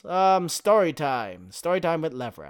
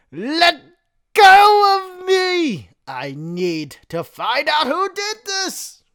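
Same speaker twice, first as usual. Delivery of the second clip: high, loud strained cry; desperate yelling; 13 semitones higher, 8 dB louder than usual. Acted. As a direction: yell while being dragged away. Xigbar struggling, yelling.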